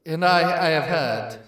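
seductive vampire, fading low; Dracula voice. Acted. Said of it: "I am Dracula".

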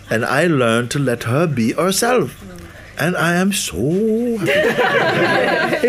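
sadhu's joy. Retold or imitated. Sadhu explains why his wife makes him happy, high and slow. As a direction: imitate the sadhu beaming about his wife, slowly.

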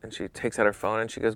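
ordinary talk, nothing marked

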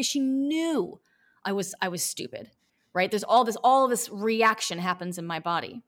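ordinary delivery

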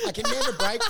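ordinary talk, nothing marked

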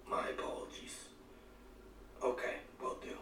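whispered, meek reply to an unseen king; pretend whisper. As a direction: hush it out like a secret telepathic call. Answering the king telepathically.

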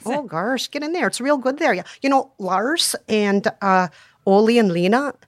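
chatty, folksy Minnesotan; homely Minnesotan neighbour talk; Minnesota accent. Shown as a demonstration. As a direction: gossip in a broad Minnesotan accent. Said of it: "I have a Minnesota accent".

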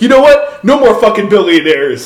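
loud, high mock rally outburst; Bernie Sanders. Candidate taking it to the next extreme, mock ranting. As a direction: escalate it like a politician riding the cheers. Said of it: "he just takes it to the next extreme".

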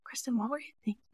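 pointed whisper, quick; hushed, probing mentor question; leaning-in whisper. Retold or imitated. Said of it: "She really leans in and asks the question".

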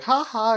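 loud, high forced laugh; a sharp fake laugh before a punch; Ran fake-laughing at Shinichi, forced laugh. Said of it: "does a fake laugh".

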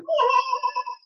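high nervous giggle; a quick anxious laugh. Real, not acted. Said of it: "you get the whole nervous laugh".

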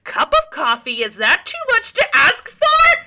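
climbing to a very high, loud yell; furious shout. A narrator's reading. Mary screams at waitress Diana over a coffee, very high and loud. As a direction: lose it completely. Mary's escalating rant.

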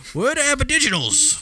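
high-pitched mock tourist question; clueless tourist. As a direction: ask like a hopelessly lost tourist. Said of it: "I was walking around asking people".

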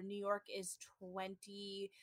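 whispered aside; whispering. Real, not acted. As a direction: drop to a quick whisper. Aside about ages.

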